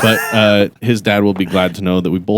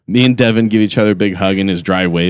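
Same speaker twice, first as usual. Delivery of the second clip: ordinary talk, nothing marked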